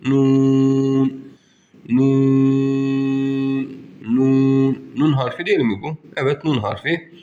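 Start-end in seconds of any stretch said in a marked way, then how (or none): 0.1-4.8 Nun held four elif, chanted; long nun. Shown as a demonstration. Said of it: "Dört elif miktarı uzatıyoruz". (Nun dört elif uzatarak)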